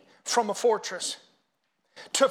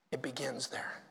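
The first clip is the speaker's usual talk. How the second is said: whisper; 8 semitones lower, 11 dB quieter than usual. Real, not acted. Pastor drops to whisper for emphasis.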